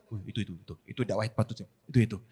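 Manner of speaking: low, very soft knowing mutter; pointing-finger murmur. Retold or imitated. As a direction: nudge your neighbour quietly. Jamaah menunjuk orang lain, berbisik. (bisik-bisik menunjuk orang lain)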